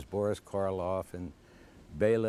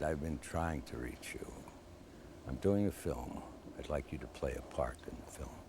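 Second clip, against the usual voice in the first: lower, softer, deep and grand; low velvet movie-legend voice; impression of a famous director's deep voice; Orson Welles voice; 8 semitones lower, 9 dB quieter than usual. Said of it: "In this deep, rich voice".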